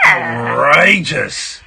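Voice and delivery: slow drawn-out mock outrage. Real, not acted. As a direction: stretch it out in fake shock.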